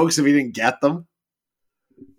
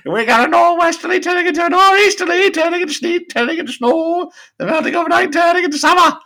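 high, louder announcer parody; weather report voice; 12 semitones higher, 5 dB louder than usual. Retold or imitated. Narrator giving an absurd weather report, high parody. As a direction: pitch it high and reel off the forecast. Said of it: "and the narrator report".

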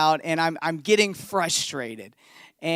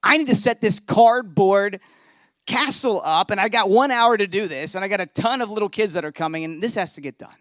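annoyed; 4 semitones higher, 6 dB louder than usual. Retold or imitated. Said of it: "And I'm just annoyed".

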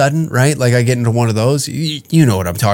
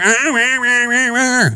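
higher nonsense rah rah; mock ranting noise; 12 semitones higher than usual. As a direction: imitate her ranting as noise. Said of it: "You come in here".

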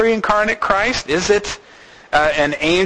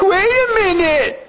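surprised. Pretend. People realizing too late.

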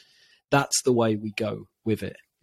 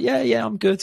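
put-on higher, lightly childlike voice; a hint of a small boy reassuring his dad; imitating his young son; 11 semitones higher than usual. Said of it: "And he's like".

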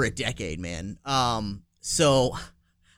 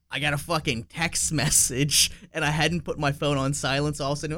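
normal talking voice

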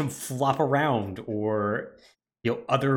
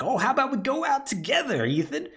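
gleeful villain Jack; 12 semitones higher than usual. Retold or imitated. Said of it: "it's like".

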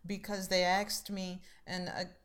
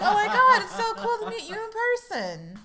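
very high, loud, gushing squeal with a laugh; starstruck, can barely contain it; thrilled fan; 13 dB louder than usual. Retold or imitated. Fan meets podcast host.

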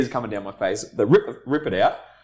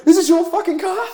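retold furious shout, much higher and louder; angry yell; 7 dB louder than usual. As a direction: yell it like an outraged sergeant catching someone in his spot. Sergeant yelling at parked-in soldier.

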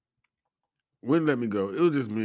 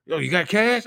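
mock attendant; 11 semitones higher, 7 dB louder than usual. Attendant asks for cash.

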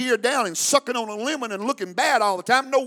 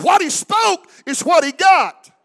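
higher and louder, shouted at full force; louder preaching shout; 7 semitones higher, 11 dB louder than usual. Real, not acted. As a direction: raise it to a ringing shout. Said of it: "I said".

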